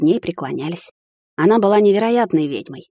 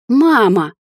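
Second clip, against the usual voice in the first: louder indignant "Мама!" with a falling end; a daughter objecting to her mother's plan; indignant outcry; 5 semitones higher, 5 dB louder than usual. A narrator's reading. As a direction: throw "Мама!" back in protest. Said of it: "вновь возмутилась я".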